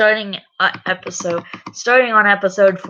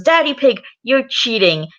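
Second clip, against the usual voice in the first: high, louder indignant scolding; accusing child; 7 semitones higher, 4 dB louder than usual. Acted. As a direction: call him out like an indignant child. Peppa catches Daddy cheating.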